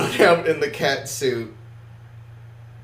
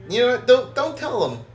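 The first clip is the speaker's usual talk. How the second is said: excited; 9 semitones higher, faster than usual. Real, not acted. Playful GM protest.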